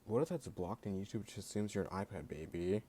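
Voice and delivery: ordinary delivery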